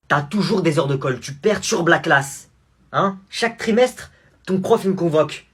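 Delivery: loud scolding mother voice; angry mother. Acted. Mother scolding son loudly. (mère en colère qui gronde)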